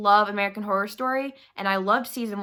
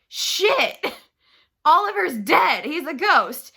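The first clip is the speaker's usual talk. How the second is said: higher, louder gasp of realization with a laugh; shocked realization; 6 semitones higher, 6 dB louder, slower than usual. Acted. Nora realizing her friend is a ghost, shocked and higher. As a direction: blurt out the twist in shock.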